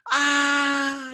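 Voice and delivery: put-on high wailing cry of a baby; a sudden high baby scream; imitated baby wail. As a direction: wail like the baby getting the shot.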